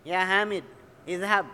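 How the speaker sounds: "Hamid" closed on d, then a separate "Izhab"; loud "Hamid", gap, "Izhab"; paused, vowelless ending. Shown as a demonstration. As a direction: stop firmly on "Hamid", pause, say "Izhab" loudly. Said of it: "Midnya juga disukunkan".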